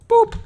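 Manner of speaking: very high, loud cartoon boop; playful boop. Player dropping meat in, high cartoon boop. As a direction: pop it out like a toy noise.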